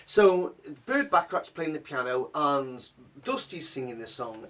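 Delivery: normal talking voice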